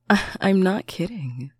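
flustered laughing protest. Acted. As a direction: laugh through the words. Laughing insistence after teasing.

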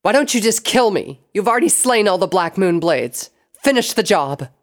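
high, loud, defiant rage; furious outburst. A narrator's reading. Sable daring Luna to kill her, furious outburst. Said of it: "The outburst caught Princess Luna off guard".

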